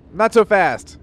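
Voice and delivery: loud, high mock hero line; hero catchphrase. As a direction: bark it like a cartoon hero. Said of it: "like the Simpsons joke".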